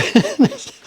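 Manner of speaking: high, loud delighted laugh; burst of laughter. Real, not acted. Delighted at being recognized.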